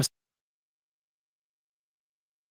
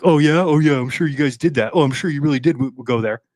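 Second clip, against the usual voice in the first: sarcastic mocking; 4 dB louder than usual. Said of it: "he's, like, talking to Duffy, like".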